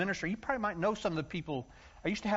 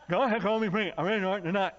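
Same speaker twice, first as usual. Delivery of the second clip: eager announcement; 4 semitones higher, 4 dB louder, faster than usual. Retold or imitated. Repeats his eager announcement, laughing.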